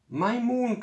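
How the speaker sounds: high anguished cry out; cried out. A narrator's reading. Abel seeing his moon shrink, high cry. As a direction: wail it to the sky in a high voice. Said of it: "cried Abel".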